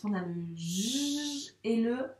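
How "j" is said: J as a long, held "zh" sound; sounded-out letter. Shown as a demonstration. Teacher sounding out a letter for a young reader, J held long. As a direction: hold J out as a steady buzzing consonant. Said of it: "On va faire le son de ces deux lettres".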